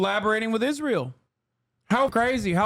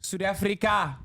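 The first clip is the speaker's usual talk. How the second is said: South African accent practice. Shown as a demonstration. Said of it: "try saying".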